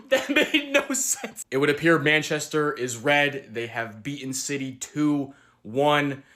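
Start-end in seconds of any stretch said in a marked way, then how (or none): 0.0-1.4 high, slow laughing disbelief; laughing in disbelief at a crazy derby result. Real, not acted. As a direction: laugh through the words in disbelief.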